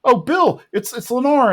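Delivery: high-pitched surprised announcement; Peggy on the phone. Retold or imitated. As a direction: break the news in a high voice. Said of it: "Peggy picks up and is like".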